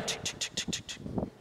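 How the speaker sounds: breathless tink tink in a whisper; voiceless clinks of chairs stacked away; faint whispered chair noises. Retold or imitated. Chairs taken down.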